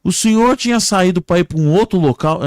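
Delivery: high, loud joke-character question; rescuer questioning. Acted. Man questioning a crash victim, loud and high. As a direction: call the question out insistently. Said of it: "o homem chegou pra ele e falou".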